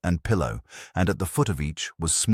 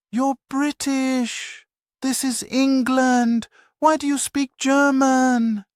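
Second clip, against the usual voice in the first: put-on much higher, slow, disbelieving voice of a young woman; bewildered questions; 4 dB louder, slower than usual. A narrator's reading. Young German prisoner stunned that an English villager speaks German, high and disbelieving. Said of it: "the words tumbling out".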